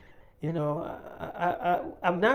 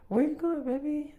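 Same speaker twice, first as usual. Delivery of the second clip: much higher-pitched imitation of a partner; imitated girlfriend's question; 10 semitones higher than usual. Girlfriend asking her man as he leaves, high-pitched questioning. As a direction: lift the voice high, asking after him.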